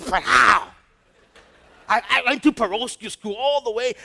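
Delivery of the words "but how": skeptic's "but how". Retold or imitated.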